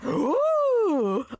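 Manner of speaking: high, loud gasping ooh; loud high gasp of surprise; surprised ooh. Retold or imitated.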